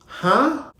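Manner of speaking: shocked huh. Retold or imitated. Hearing Brandon is dead.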